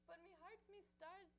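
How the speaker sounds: hammed-up Irish whimpering; mock sobbing. Leprechaun mourning lost charms.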